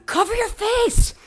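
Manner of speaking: high, slow, put-on outraged yelp; mock shocked cry. Imitating a shocked person at an unmasked face, high and slow. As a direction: squeal it like someone scandalised. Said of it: "You're like".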